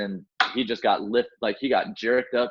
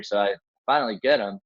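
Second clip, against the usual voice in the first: ordinary talk, nothing marked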